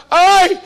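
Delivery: high, loud mock cries of pain; hammed-up birthing groans; labour cries. Preacher acting out Rebekah giving birth, mock pain cries.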